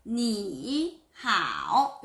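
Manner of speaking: slow whispered 니하오, each third tone dipping; full tones. Shown as a demonstration. Teacher showing the awkward full-tone version, slow whisper. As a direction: carefully dip both syllables of 니하오. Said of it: "성조를 다 지키려면".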